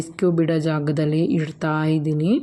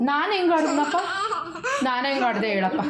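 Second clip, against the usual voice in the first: laughing tease; 10 semitones higher than usual. Real, not acted. Mother laughingly teases her daughter, high and giggly.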